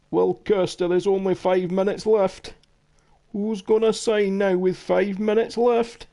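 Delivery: high-pitched mock Scottish presenter. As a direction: put on a Scottish TV deadline-day voice.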